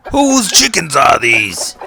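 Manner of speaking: very loud, slow howl; howled call. Acted. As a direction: howl it over the market. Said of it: "the nut hawker howled".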